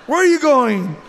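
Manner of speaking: loud, high-pitched demand; calling after him. Acted. Wife to Joseph as he pulls away, calling loudly.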